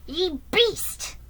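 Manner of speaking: high-pitched burst of anger; angry shout. A narrator's reading. Jiggy Nye at the rearing horse, angry shout. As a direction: shout it at an unruly animal. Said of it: "Mister Nye shouted".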